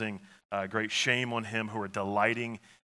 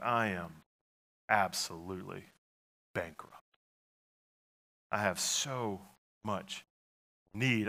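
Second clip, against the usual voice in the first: broken confession; 3 semitones lower, 4 dB quieter than usual. Shown as a demonstration. Pastor trying David's line as a broken plea. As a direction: let it fall apart, barely there. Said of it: "Or is it like".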